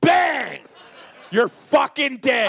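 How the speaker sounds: loud, high play-acted gunman's shout; big drawn-out mock threat; mock killing shout. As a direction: shout it like a pretend gunshot. Acting out a Deadwood killing.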